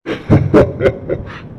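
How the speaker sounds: breathy evil cackle; witch cackle. Acted. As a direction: cackle like a hag. Witch appears again.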